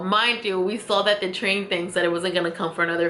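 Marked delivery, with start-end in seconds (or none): none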